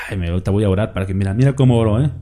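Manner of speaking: hammed-up self-satisfied pray-er, rushed; mock show-off. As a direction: rattle it off like a vain hypocrite showing off.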